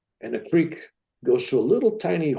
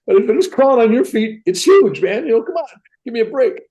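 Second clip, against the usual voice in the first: high, louder defensive protest; mock protest; 9 semitones higher, 4 dB louder than usual. Man arguing with his wife about the snake size, mock protest. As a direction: plead your case to your wife.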